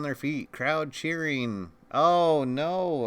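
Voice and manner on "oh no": excited. Real, not acted. Interference in the match.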